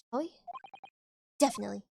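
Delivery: ordinary talk, nothing marked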